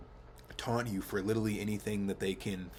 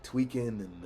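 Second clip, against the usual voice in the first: normal talking voice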